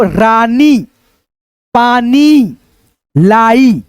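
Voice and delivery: रानी, पानी, लाई read slowly, each word apart, long vowels drawn out; stretched word by word. Shown as a demonstration. Teacher reading a sentence to pupils, word by word. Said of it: "हम इसको खींच करके थोड़ा सा पढ़ते हैं".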